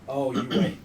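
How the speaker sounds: usual speaking voice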